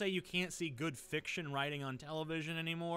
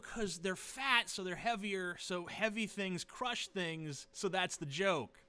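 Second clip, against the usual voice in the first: slow sarcastic explaining; 4 semitones higher, slower than usual. Real, not acted. Slowly spelling out the joke.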